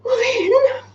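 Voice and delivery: squeaky high mock dismay; mock disappointment. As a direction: pretend to be hurt, way up high.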